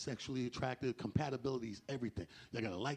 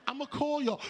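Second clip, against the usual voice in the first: put-on high girlfriend promise; 10 semitones higher, 3 dB louder than usual. Girl promises to call.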